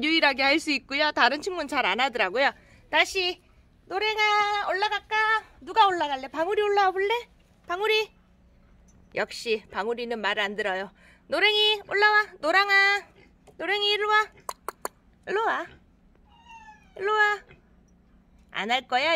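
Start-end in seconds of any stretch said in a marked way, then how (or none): 2.9-8.1 coaxing pet talk. Real, not acted. Coaxing cats up. 11.3-17.4 calling the cat. Real, not acted.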